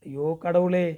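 plain reading voice

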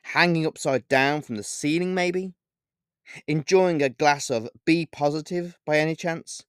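sarcastically. A narrator's reading. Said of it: "I replied sarcastically".

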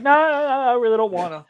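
imitated reluctant brush-off; mock refusal. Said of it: "he's like".

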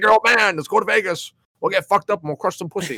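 high, pumped-up party dad; rowdy dad voice. Retold or imitated. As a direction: hype it up like a wild father. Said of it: "Be like".

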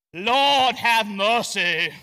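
loud, drawn-out mock-holy praying; put-on prayer voice. Churchgoer suddenly praying in King James language, pompous and loud. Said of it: "people who talk like me right now".

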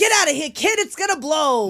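high, loud movie-hero yell; shouted warning. Acted. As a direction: shout an urgent warning to get clear. Acts out warning before explosion.

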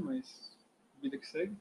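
hoarse with a sore throat; strained by a sore throat. Real, not acted. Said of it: "minha garganta tá doendo". (com a garganta doendo)

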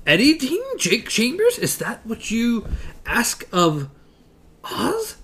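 pitched high, rising on each name; mock-grand wizard calling out names; high questioning Oz. Oz quizzing the travellers, high and rising.

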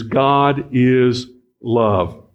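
each word drawn out slowly; slow, deliberate focus. Shown as a demonstration. Preacher zeroing in on one phrase, slow and deliberate. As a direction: stretch it out, letting every word land. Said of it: "zero in on that one phrase".

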